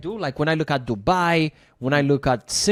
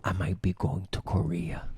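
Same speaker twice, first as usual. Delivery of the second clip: secret whisper; 8 semitones lower, 11 dB quieter than usual. Real, not acted. Sharing a secret plan.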